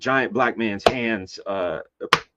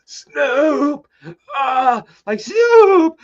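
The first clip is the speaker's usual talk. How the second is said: gasping his name, high and strained; breathless cry calling out; out of breath; 5 dB louder, much slower than usual. Retold or imitated. Squeezed by the bouncer, calling to [name] breathlessly. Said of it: "like, just out of breath".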